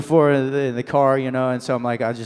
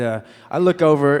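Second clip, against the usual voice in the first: ordinary talk, nothing marked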